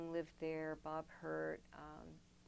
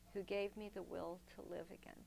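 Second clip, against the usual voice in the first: normal talking voice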